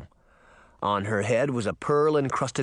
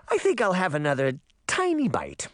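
higher princess voice through a mouthful; mouth full; 7 semitones higher than usual. A narrator's reading. Princess helps herself to more, mouth lightly full. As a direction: talk round a bite of cake. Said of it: "said with her mouth full".

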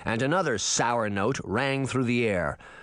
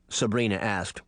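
ordinary delivery

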